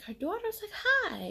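put-on a bright high hi to a child; high sweet hello. Meeting the dead friend's daughter, a high gentle hi.

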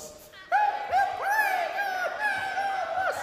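silly high hippo voice. Pretend. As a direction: sing it like a cartoon hippo. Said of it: "my hippopotamus voice".

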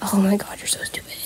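unvoiced whisper, under the breath; whispered insult. Real, not acted. Teasing her friend under her breath, whispered. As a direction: breathe it so only she hears.

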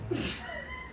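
a very high, soft squeal of laughter. Real, not acted. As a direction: burst into a squeaky giggle. Laughing at partner's teasing.

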